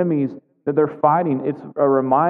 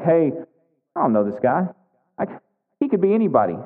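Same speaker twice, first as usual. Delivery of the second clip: contemptuous; faster than usual. Retold or imitated. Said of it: "you can hear the contempt in Nabil's voice".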